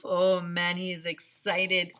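plain reading voice

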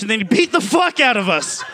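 ordinary talk, nothing marked

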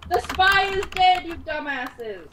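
slow, loud, spelled-out scolding; exasperated yell. Real, not acted. Dead spy telling off the players, exasperated. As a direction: holler it slowly at the table.

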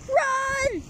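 sudden high cry of alarm; panicked yell. Acted. Character yelling at friends to flee. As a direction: shout it, get out now.

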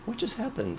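a mock-amazed high squeak of disbelief; awed high question. Straight colleague moved by the chorus, a hammed-up high awe. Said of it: "so he goes".

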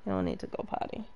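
a soft breathy whisper, no voice at all. Real, not acted. Whispering beside his sleeping little dog. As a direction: whisper so the sleeping pup stays asleep.

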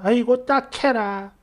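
put-on slow, tutting old shaman voice; old fortune-teller pitying. Shaman granny pities the client's bad luck, slow tutting. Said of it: "이러더랍니다".

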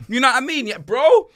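normal talking voice

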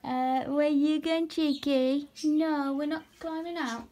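cheeky warning to the escaping spider; doting half-stern pet-voice; mock scolding pet. Real, not acted. Stopping spider from climbing out.

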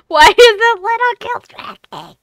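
very high, loud, teary laughter; laugh-crying. Real, not acted. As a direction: cackle it out through tears. Streamer laughing at broken game.

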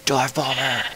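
high cry rising into a loud yell; playful battle cry. Pretend. Player diving in the game, playful high yell. Calls out an attack in play.

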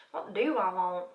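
Southern vowels, stretched on "want"; Southern accent. Real, not acted. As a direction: slip into a playful Southern accent. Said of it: "I'm Southern today".

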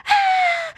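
high, loud squeal of joy; retold excited shriek. Friend on phone hearing of release, high imitated shriek.

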